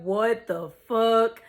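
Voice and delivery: slow, drawn-out disbelief; slow-motion reaction; retold bewilderment. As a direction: drag the words out, baffled. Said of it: "everything started moving in slow motion".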